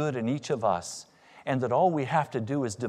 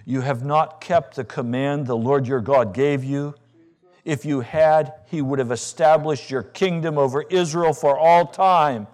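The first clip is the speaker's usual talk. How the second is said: loud pulpit proclamation; louder sermon pronouncement; forceful preaching; 4 semitones higher, 9 dB louder than usual. Acted. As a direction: thunder the verdict from the pulpit. Preaching scripture at full force.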